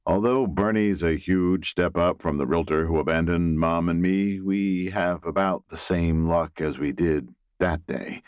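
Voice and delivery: ordinary delivery, nothing marked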